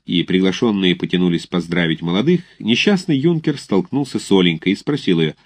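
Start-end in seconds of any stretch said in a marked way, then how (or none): none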